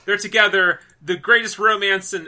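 real fan excitement; elated proclamation. Real, not acted. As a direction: gush over the long-awaited reunion. Keeps celebrating the reunion.

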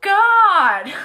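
loud, high, drawn-out groan of frustration; exasperated outburst. Real, not acted. Whale fan fed up with the news, loud exasperation. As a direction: cry out in laughing exasperation.

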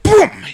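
very high loud shouted boom; punch sound. Retold or imitated. Telling how mom punched the kid, loud boom. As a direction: shout the impact.

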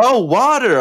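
high, loud American "oh" as it finally clicks; American bartender. Retold or imitated. Nashville bartender finally understanding the order, high mock American accent. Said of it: "she was like".

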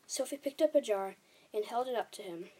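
ordinary delivery, nothing marked

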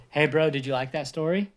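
plain reading voice

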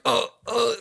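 high, hammed-up groans; mock choking groans. Senators clutching chests after drinking, pained groans. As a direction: fake a chest-clutching collapse.

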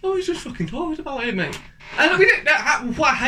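quick, indignant Cockney outburst; gone Cockney. Real, not acted. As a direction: slip into an exasperated Cockney. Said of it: "Why have you just gone Cockney?".

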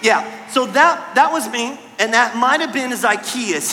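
ordinary talk, nothing marked

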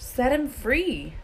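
slow, high-pitched urging. A narrator's reading. Harry presses Hagrid to release the dragon, urging. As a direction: plead with stretched-out words. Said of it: "Harry urged".

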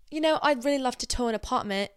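lightly imitated British lady; British accent. British lady asking the speaker, imitated British accent. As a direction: put on a polite British accent. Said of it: "This British lady came in".

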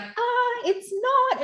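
high-pitched mock protest. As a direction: squeak it out like horrified onlookers. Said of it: "everyone being like".

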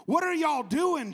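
high, slow Southern "What are y'all doing?"; Jesus rebuking the church in a Southern drawl. Acted. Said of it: "I'm Southern".

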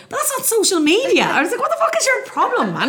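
high, laughing exasperation; mock outrage through laughter; laughing disbelief. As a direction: laugh it out at the absurdity. Laughing disbelief at his logic.

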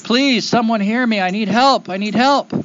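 high-pitched desperate calling; pleading cry for help. Acted. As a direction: call up to the distant city, begging. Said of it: "he cries up to the Turquoise City".

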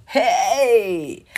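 ordinary talk

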